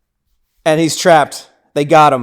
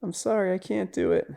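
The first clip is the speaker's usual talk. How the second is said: soft, high, helpless mock plea; imitated whimper; 5 semitones higher, 10 dB quieter than usual. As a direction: whimper it quietly, acting unable to go through with it.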